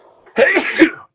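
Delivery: big booming dad sneeze; one sudden, explosive sneeze; loud sneeze. Real, not acted. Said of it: "I'm gonna sneeze".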